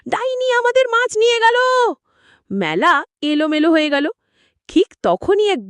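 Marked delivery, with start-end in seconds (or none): 0.1-1.5 high, alarmed shout; fishmongers shouting. Acted. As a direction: cry out that the witch stole the fish. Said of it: "মাছওয়ালারা চিৎকার শুরু করল".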